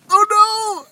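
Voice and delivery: high, dismayed oh no; shocked. Retold or imitated. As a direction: gasp it in horror. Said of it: "I was just like".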